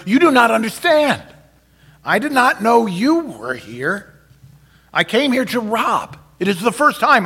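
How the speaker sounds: high, strained pleading confession; desperate confession. Acted. Son confessing to his mother, high and strained.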